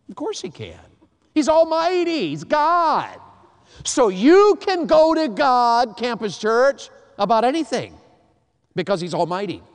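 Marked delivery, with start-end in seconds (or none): 1.3-3.2 high, loud proclamation of He's almighty; preaching shout. Real, not acted. Preacher shouts God's power. 3.8-7.9 preaching shout. Real, not acted.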